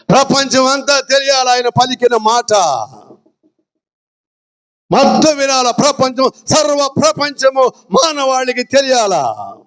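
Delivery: high-pitched preaching call; high proclamation. Real, not acted. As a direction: proclaim it for the whole world to hear. Preacher proclaiming to the whole world.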